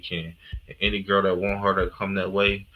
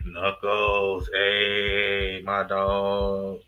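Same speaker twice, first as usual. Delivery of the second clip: ordinary delivery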